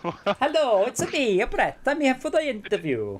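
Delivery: put-on Italian accent. Greeting the interviewer as Brett in a hammed-up Italian accent. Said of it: "Just speak in an Italian accent".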